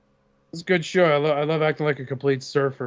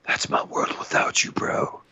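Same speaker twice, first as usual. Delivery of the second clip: sappy whisper. Retold or imitated. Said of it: "he's like".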